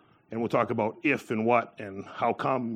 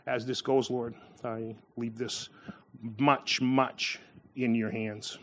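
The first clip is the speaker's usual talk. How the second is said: slow, soft, slightly lowered prayer; hushed prayer; 4 semitones lower, 6 dB quieter, slower than usual. Real, not acted. Preacher laying the sermon before the Lord, hushed prayer. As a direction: hand it over quietly, word by word.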